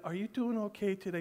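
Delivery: soft, tender check-in with your wife; gentle caring question. Shown as a demonstration. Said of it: "Notice the tone of voice here".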